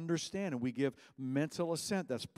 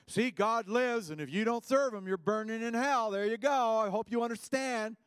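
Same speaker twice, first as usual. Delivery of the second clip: high, loud, hectoring pitch; mock an evangelist talking people to death; preachy lecturer; 9 semitones higher, 6 dB louder than usual. Mimics pushy argumentative evangelist.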